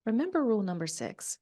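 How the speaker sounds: soft, soothing hush; calm mom. Retold or imitated. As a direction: gently settle frantic kids down. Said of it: "she just calmly goes".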